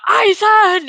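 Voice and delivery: a loud retold shout of the name. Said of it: "all we get is Captain Komamura".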